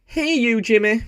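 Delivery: very high, loud sing-song hello; squeaky cartoon hello to a viewer; silly high greeting. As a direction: greet him in a goofy falsetto. Comic greeting voice.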